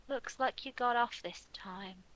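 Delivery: slow whispered pout. Acted. Reader pouting at the man's lucky card, whispered. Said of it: "sigh and pout and say".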